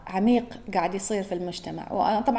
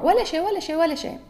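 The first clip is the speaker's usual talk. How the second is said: high emphatic insistence; 7 semitones higher, faster than usual. Real, not acted. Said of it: "emphatic denial".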